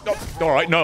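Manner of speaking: usual speaking voice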